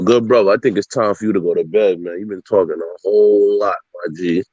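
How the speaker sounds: ordinary talk, nothing marked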